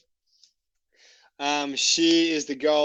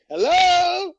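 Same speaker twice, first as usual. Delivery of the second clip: high, loud sing-song hello; playing stupid; 13 semitones higher, 7 dB louder than usual. Knocking again and pretending not to hear the lady, a loud innocent high call. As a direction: call out as if you heard nothing. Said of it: "I was like".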